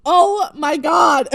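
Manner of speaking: slow, loud, high "oh my God"; overwhelmed exclamation. Real, not acted. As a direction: draw it out, overcome by the drama. Overwhelmed by the scene.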